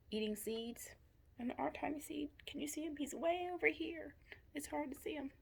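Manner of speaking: ordinary delivery, nothing marked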